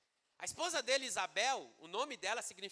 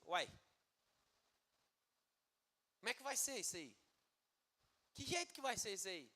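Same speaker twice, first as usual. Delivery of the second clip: softer, sceptical aside with long pauses; doubtful questioning; 7 dB quieter, faster than usual. Acted. Zacarias questioning the angel, quietly doubtful. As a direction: question it as if unconvinced. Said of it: "Ele duvidou da".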